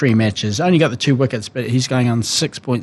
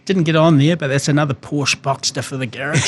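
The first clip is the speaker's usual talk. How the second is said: smug benched player; 4 semitones higher than usual. Retold or imitated. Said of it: "You're just sitting on the sideline going".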